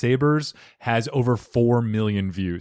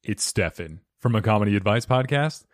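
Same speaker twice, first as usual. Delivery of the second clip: normal talking voice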